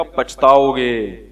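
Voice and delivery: slow, drawn-out ominous warning. Retold or imitated. Cleric warning a father about a school, slow and ominous. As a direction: stretch each syllable like a threat. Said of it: "میں نے کہا".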